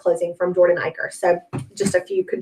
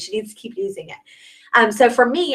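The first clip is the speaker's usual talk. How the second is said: ordinary talk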